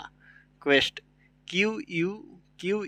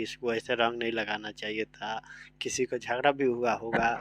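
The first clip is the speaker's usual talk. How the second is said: whisper; 3 semitones lower, 6 dB quieter than usual. Acted. Teacher whispers village gossip aside.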